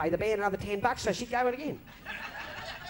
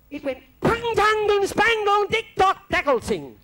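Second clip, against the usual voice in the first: high, loud clanging noises; gramophone springs flying apart; 12 semitones higher, 5 dB louder, slower than usual. Retold or imitated. The gramophone bursting, clanging sounds imitated high and loud. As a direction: spit out each clang of the exploding springs.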